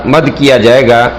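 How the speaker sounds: ordinary talk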